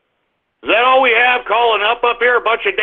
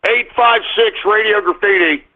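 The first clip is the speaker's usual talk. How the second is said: usual speaking voice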